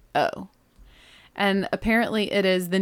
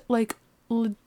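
normal talking voice

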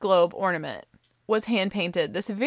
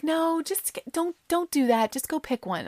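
high-pitched imitation of friends; insistent friends; 9 semitones higher, 3 dB quieter than usual. Friends talking her out of it, high insistence. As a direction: lightly scold in a raised voice.

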